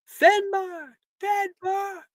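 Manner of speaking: high call from far off, slowed; distant voice calling to Finbar through the walls. Acted. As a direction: shout his name from far away. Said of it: "you can hear a voice. It's calling out for you".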